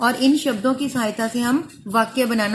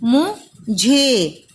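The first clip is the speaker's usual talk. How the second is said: drawn-out sounding; much slower than usual. Shown as a demonstration. Teacher sounding out मुझे slowly for pupils. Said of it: "मुझे को देखो कैसे लिखेंगे".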